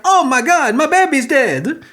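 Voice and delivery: high, hammed-up Italian wail; mock Italian accent. Commentator imitates a parent finding the baby, in mock Italian accent. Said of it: "I don't know why I went Italian".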